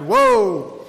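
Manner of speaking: shouted command. A narrator's reading. Boy stopping calves at the road, shouted. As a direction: holler the team to a halt. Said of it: "when he shouted".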